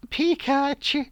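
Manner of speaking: high, loud, stretched cartoon creature cry; hammed-up imitation of an animated critter; Pikachu. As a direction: squeal the name as a tiny monster. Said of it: "that Pikachu was going".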